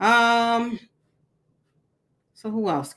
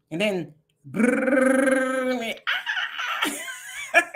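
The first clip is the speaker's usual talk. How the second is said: rumble imitation; 4 semitones higher, much slower than usual.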